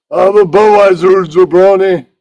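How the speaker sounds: high, loud wrestler bellow, rolled and hammy; Iron Sheik voice. Acted. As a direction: bark it out like a cartoon wrestler heel. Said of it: "Iron Sheik goes".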